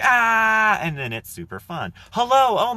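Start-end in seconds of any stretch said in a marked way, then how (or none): 0.0-0.8 very high, loud playful shriek; pitched-up fake shout; mock scream. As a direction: let out a hammed-up yelp. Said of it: "they're like".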